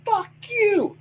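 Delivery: high-pitched defiant yell back; defiant retort. Retold or imitated. Paul yelling back at his captain, high defiant retort. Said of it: "I'm going".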